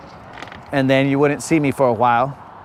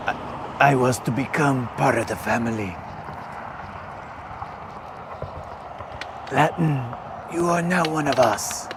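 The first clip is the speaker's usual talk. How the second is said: low, soft mafia-boss voice; Italian mobster; 4 semitones lower, 7 dB quieter than usual. Acted. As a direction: pronounce it like a godfather welcoming family. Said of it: "you sound like a, an Italian mobster".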